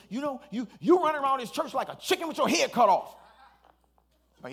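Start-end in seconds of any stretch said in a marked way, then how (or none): 0.1-3.2 higher, louder angry accusation; young man angry on the phone; angry retort. Retold or imitated. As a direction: snap at him in anger. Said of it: "and he's mad".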